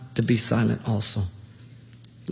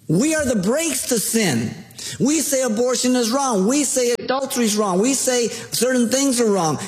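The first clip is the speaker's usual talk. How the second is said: raised, urgent sermon voice; impassioned preaching; 8 semitones higher than usual. Acted. Pastor illustrating obedience with a child's game, fervent preaching. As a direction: preach it to the pews with rising fervour.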